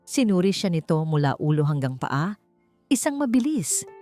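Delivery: plain reading voice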